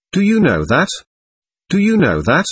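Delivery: sudden warm exclamation. A narrator's reading. Woman praising another woman to her listener, warmly exclaimed. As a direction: exclaim it with sudden warmth, lifting the pitch. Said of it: "exclaimed suddenly with extraordinary warmth".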